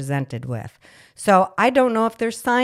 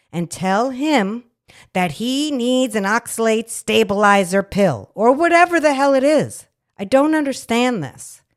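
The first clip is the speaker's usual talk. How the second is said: loud, high indignant rant; angry; 5 semitones higher, 6 dB louder than usual. Real, not acted. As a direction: let the outrage build. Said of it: "I'm mad right now".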